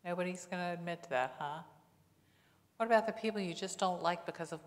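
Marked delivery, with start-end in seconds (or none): none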